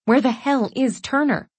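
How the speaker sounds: ordinary delivery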